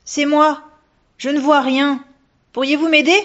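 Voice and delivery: high, loud plea into the dark; calling for help. A narrator's reading. Lost girl calls to the ghost for help, high and loud. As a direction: call out asking for help.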